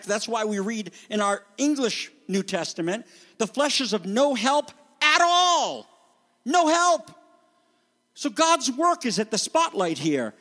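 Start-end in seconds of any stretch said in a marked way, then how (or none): 3.4-7.1 "no help" punched higher and louder, slowly; emphatic. Shown as a demonstration. Preacher stressing the text to the church, "No help" hit high and loud. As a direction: hammer home "The flesh is of no help at all". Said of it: "and this is emphatic".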